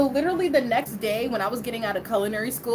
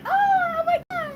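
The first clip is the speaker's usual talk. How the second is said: very high fake-frightened cry; mock panic; much faster than usual. Prankster fakes danger to girlfriend on phone, mock panic. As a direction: squeal in pretend terror. Faking being attacked on prank call.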